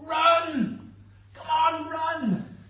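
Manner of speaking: high, loud race-side cheering; full-voiced urging on a runner; spectator's shout. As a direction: yell encouragement from beside the track. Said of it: "and we shout".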